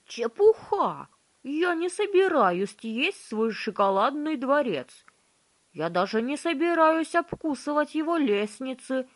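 high, loud petulant prince; spoiled prince voice. A narrator's reading. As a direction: stamp and protest like a spoiled prince. Prince's indignant reply.

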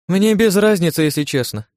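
high, loud, offhand answer; breezy indifference, falling off at the end; unthinking breezy reply. A narrator's reading. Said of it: "не задумываясь, ответила Ирен".

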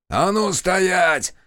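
drawn-out shout of halt; shouted order. A narrator's reading. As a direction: yell to stop the runners. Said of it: "заорали с деревенской улицы".

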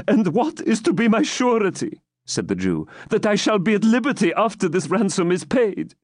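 put-on high, worried old man; anxious old man. Captive asking the baron for surety, anxious. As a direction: ask fearfully for a pledge. Isaac asks.

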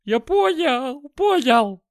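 high, loud wail of pain; frantic howl. A narrator's reading. Dealer with a smashed kneecap, howling frantically. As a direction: scream it in agony, stretching the words. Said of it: "мужик неистово заорал".

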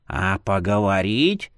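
put-on slow, sinister old man voice; creepy old man. A narrator's reading. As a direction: drawl it like a taunting elder.

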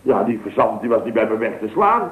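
ordinary talk

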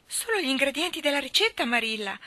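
put-on alarmed child. A narrator's reading. Child answers guardian about the cake, already alarmed. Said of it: "mormorò la bambina già allarmata".

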